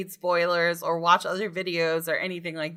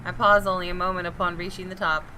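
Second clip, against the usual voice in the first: steady, measured first-person narration; story narration; 2 semitones lower than usual. Acted. Reading the detective's inner monologue to viewers, measured narration. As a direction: read it like a tense mystery novel. Reads game narration aloud.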